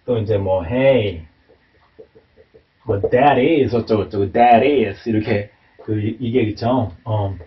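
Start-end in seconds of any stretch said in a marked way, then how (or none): 3.0-3.7 THAT IS linked with a tapped T, like "tha-dis"; "that is" with the final T of "that" softened to a tap; flapped T. Shown as a demonstration. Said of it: "이렇게 플랫 발음으로". 4.3-5.1 THAT IS linked with a tapped T, like "tha-dis"; "that is" with the final T of "that" softened to a tap; flapped T. Shown as a demonstration. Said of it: "이렇게 플랫 발음으로".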